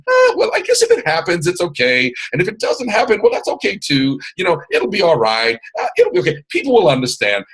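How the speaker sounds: high, noncommittal acted shrugging-off; mock indifference. Coach mimicking someone without desire, mock indifferent. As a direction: play someone half-hearted, voice sliding up high. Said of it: "you cannot just kinda".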